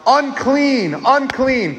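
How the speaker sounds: high called-out cry of unclean; mournful warning call; leper's cry. Retold or imitated. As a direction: call it out like an outcast warning people off. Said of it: "they have to scream out".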